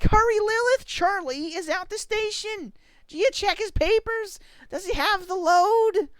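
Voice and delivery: loud, very high play-acted alarm; rally a child to a toy emergency; mock urgency.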